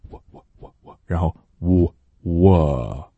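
pouting wo, lips rounded then glide; 撅嘴发我; repeated Chinese wo. Shown as a demonstration. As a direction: show the w glide on 我. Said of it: "比如说汉语里的那个我".

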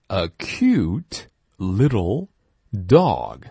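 very slow a cute little, rising on little; slow model phrase. Shown as a demonstration. Teacher reading a phrase slowly for repeating. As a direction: stretch each word for students to copy. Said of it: "Now repeat after me".

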